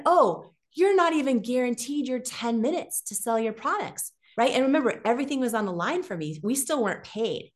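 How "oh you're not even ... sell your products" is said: mock outrage at the rules; shocked realization. Founder recalling her shock at QVC's rules, stunned.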